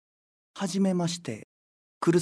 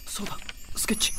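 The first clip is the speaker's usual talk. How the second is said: whisper; 3 semitones higher, faster than usual. Acted. Hiding, reminds himself.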